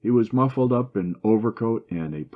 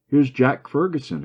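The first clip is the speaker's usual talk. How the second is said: higher and louder, a sudden call of news; exclamation; 7 semitones higher, 6 dB louder than usual. A narrator's reading. Malcolm at the window tells the room, excited. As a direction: blurt out the arrival. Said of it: "exclaimed Malcolm".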